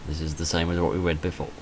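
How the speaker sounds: ordinary talk, nothing marked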